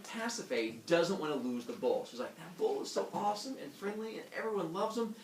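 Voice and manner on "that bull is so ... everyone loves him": higher-pitched coaxing wife voice; lightly voiced queen pleading for her pet. Queen pleads to keep bull.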